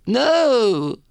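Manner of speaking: high-pitched bashful no; high squeaky no. Retold or imitated. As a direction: squeak out a coy refusal. Mock refusing wife's urging.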